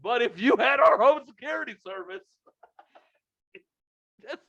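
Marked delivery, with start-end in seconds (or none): 0.0-2.2 high mock sales pitch broken by laughter; imitating the salesman while cracking up; salesman voice, laughing. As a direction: laugh through a cheery telemarketer imitation. Retelling the salesman again, laughing.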